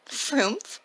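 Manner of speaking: surprised, rising Frumph?; puzzled question. Acted. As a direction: ask back the strange name, puzzled. Santa repeats the odd name.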